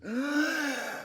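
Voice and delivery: big audible breath in; quick theatrical inhale; sharp gasp. Acted. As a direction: gulp air before racing on. Big breath before next recap part.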